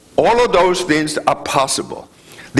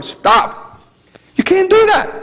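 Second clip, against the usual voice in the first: much higher, louder Stop; retold indignant objection; mock protest; 4 dB louder, slower than usual. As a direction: shout them down, high. Mapuche protesting water theft.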